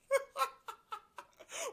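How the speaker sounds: loud shrieking laugh; big real cackle; high cackling laugh. Real, not acted. Laughing at the article.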